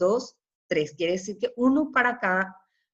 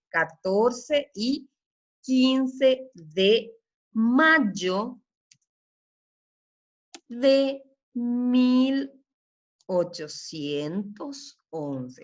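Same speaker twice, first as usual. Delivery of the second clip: slow dictation; much slower than usual. Shown as a demonstration. Teacher dictating the date slowly.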